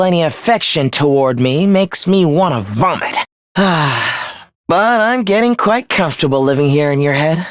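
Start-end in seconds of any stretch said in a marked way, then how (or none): 3.6-4.3 short, audible villainous sigh; exasperated sigh. Acted. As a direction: let out a breath of disdain. Villain disgusted at thought of affection.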